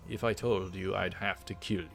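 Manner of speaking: normal talking voice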